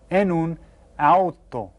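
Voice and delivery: "en un auto" slowly, every letter sounded; careful Spanish pronunciation. Shown as a demonstration. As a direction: model "en un auto" for the class. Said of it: "you hear how I pronounce that".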